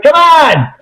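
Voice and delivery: loud, high mock outrage; exasperated shout. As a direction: yell it like you got cheated. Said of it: "I'm like".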